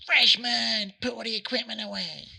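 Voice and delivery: loud, high-pitched mock yell of an order; imitated bossy yell. Senior girl ordering freshmen to clear up, imitated yell. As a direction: call it out high, like a girl ordering juniors about.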